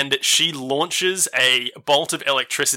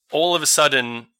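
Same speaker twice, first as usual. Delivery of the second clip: ordinary talk, nothing marked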